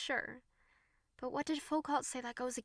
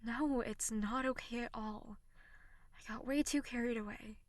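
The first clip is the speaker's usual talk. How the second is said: ordinary delivery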